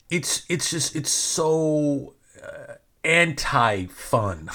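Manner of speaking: ordinary delivery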